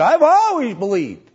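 high, loud retold protest; hammed-up loud insistence on ALWAYS; mock insistence. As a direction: insist loudly, imitating an indignant churchgoer. Lady's insistent repeat, retold.

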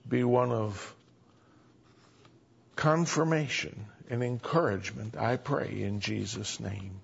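soft, earnest prayer voice. Acted. Pastor prays softly for the folks gathered on Sunday night. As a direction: pray quietly over the congregation.

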